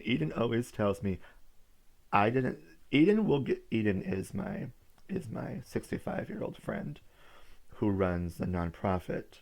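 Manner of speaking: ordinary talk, nothing marked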